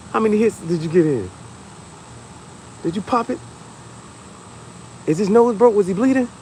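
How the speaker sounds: hyped parent. Pretend. Parent in the car hyping up their kid, excited. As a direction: hype the kid up about the fight.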